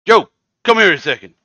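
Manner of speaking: high, loud call to the back room; yelling out back. Acted. Host yells to Joe out back, loud call. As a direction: shout for Joe through the back. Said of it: "he yells out the back here".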